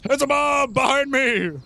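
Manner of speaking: high urgent battle shout; Callahan shouting. Acted. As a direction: yell the warning to the party. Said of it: "Callahan shouts".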